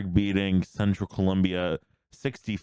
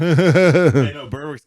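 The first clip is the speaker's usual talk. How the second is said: laughing; 8 semitones higher, 11 dB louder, slower than usual. Real, not acted. Laughing while praising Berwick.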